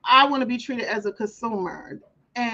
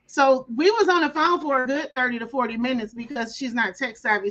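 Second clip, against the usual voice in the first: ordinary talk, nothing marked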